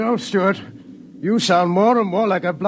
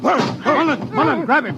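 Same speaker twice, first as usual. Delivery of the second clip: urgent shout; 9 semitones higher than usual. Acted. Colonel calls for help in scuffle.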